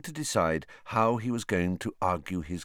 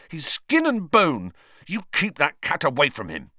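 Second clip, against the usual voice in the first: furious; 7 semitones higher, 4 dB louder than usual. Acted. Ron furiously showing Hermione his thin rat. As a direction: scold her with mild fury. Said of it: "he said furiously".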